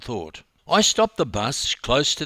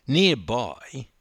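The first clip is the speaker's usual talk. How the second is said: ordinary delivery, nothing marked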